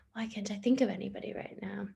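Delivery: whispered to herself, thinking. Real, not acted. Teacher whispers to herself while thinking of a name. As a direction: muse it under your breath. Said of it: "whispered musing aside".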